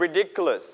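ridiculous with the second syllable dic punched; stress on DIC. Shown as a demonstration. Teacher models ridiculous for the class, stress on dic. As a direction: hit the dic in ridiculous slowly. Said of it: "You stress on dick".

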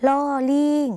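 ลอลิง called out high and bright, teacher-style; letter name called out. Shown as a demonstration. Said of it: "หอฮาน บวกด้วย".

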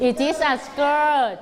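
slow, clear full-sentence answer; slow model answer. Shown as a demonstration. Teacher modelling the answer for pupils, slow. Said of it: "What is it?".

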